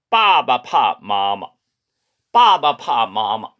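빠바 파 마마 said very slowly with clear tones; 중국어 발음 시범; slow Chinese model. Shown as a demonstration. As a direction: spell out 빠바 파 마마 slowly for learners. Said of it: "하면 아빠는 엄마 두려워합니까".

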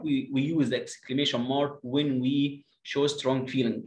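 put-on slow, clear reading for children; slow teacher read-aloud; slow model reading. Shown as a demonstration. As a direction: read it out slowly, word by word. Reads the rule slowly.

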